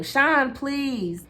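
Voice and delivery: high, urgent plea; high desperate plea; pleading. A narrator's reading. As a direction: beg him, voice up high. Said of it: "I pleaded with him".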